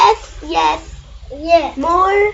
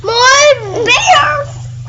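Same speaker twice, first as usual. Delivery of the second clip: much higher, loud squeal; shrieked cheer; 11 semitones higher, 6 dB louder than usual. Real, not acted. Child joining parent's refrain, squealing loudly. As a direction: squeal it with joy.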